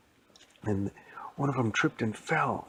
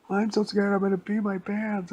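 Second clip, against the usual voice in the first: high loud panicky squeal; terrified boy; 11 semitones higher, 6 dB louder than usual. Acted. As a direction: wail it in comic terror.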